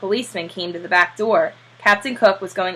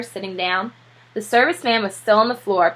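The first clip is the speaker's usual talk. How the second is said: plain reading voice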